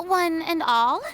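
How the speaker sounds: ordinary talk, nothing marked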